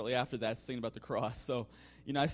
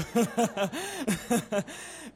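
a real burst of high laughter; hearty laugh; 9 semitones higher, 7 dB louder than usual. Real, not acted. Speaker laughs at the boy's answer, hearty laugh. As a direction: laugh out loud, delighted.

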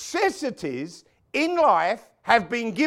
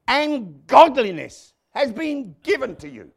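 loud, raised, emphatic sermon delivery; full-force preaching; 4 semitones higher, 7 dB louder than usual. Real, not acted. Preacher telling congregation it's in the seed, forceful preaching. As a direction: drive it home, punching key words.

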